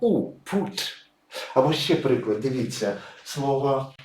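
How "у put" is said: «put» said with an «у» vowel; vowel read as «у». Shown as a demonstration. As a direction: sound out «у», then «put», higher pitched. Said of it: "вже вимовляється як".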